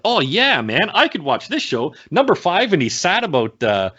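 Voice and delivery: high-pitched enthusiastic retelling of your own reaction; excited reaction. Retold or imitated. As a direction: act out getting suddenly on board.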